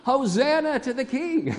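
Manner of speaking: high, jubilant mock cheer; crowd crying out. Crowd welcoming Jesus a week before, high cheer. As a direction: hail the king like an adoring crowd. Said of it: "cried out".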